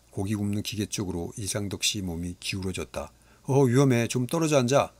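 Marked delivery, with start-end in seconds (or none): 3.4-4.9 alarmed warning. Acted. Father warns about the grill.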